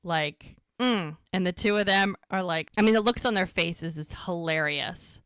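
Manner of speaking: normal talking voice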